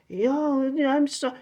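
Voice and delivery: high, soft mock voice; mock casual voice. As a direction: lift it high and light, mocking. Mocks what people think God sounds like.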